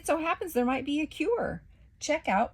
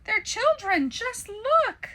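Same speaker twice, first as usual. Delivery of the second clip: high, loud furious yell; outraged; 14 semitones higher, 7 dB louder, slower than usual. Acted. Waffle yelling at Biscotti about the children.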